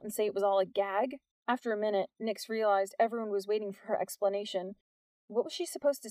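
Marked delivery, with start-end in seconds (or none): none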